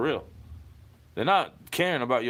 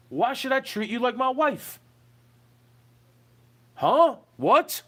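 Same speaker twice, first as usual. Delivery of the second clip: indignant shout; 8 semitones higher, 5 dB louder, slower than usual. Real, not acted. Ranting at an absent partner.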